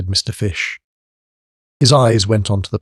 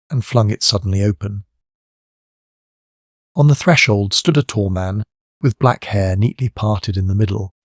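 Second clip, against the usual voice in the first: usual speaking voice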